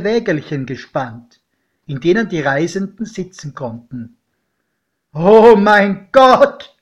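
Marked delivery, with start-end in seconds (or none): 5.1-6.7 very loud, slow horrified exclamation; loud cry. Acted. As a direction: cry it out in awe and dread, drawn out. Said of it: "rief mein Pate".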